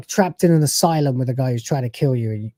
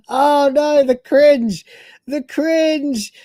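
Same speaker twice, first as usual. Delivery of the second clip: mock horror; 11 semitones higher, slower than usual. Mock horror at cringe video.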